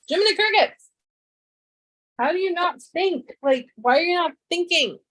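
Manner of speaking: high exasperated ranting. Real, not acted. Streamer venting at packaging designers, high exasperated. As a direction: plead with the people who made it.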